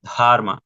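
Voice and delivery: dharma said loud, from the throat; throaty dharma. Shown as a demonstration. Teacher repeats dharma for the class with a guttural accent. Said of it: "como cierto acento desde la garganta".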